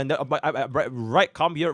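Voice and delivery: normal talking voice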